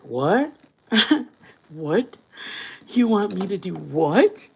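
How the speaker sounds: laughing mock disbelief; playful disbelief. Shown as a demonstration. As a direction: act baffled and amused. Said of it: "I think I said something like this".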